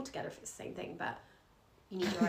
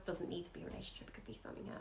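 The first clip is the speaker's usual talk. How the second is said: fast, softer whispered aside; 10 dB quieter, faster than usual. Real, not acted. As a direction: drop to a whisper and hurry it. Aside after the universe quote.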